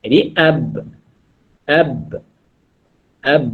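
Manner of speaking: "ab" with a slight bounce on the b; teacher demonstrating qalqalah on "ab"; ab demo. Shown as a demonstration. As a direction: repeat "ab" slowly. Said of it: "baris fathah bertemu dengan ba mati".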